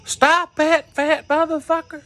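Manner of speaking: high mock bouncer yell; bouncer hollering. Bouncer shouting after the fleeing teller, high mock yell. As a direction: holler it like a bouncer giving chase.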